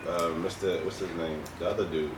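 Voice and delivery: ordinary delivery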